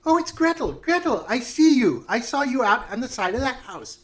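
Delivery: high, loud, singsong witch; delighted witch. Acted. Witch spots Gretel behind the player, high singsong calling. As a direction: call out in high witchy recognition. Game master plays the witch.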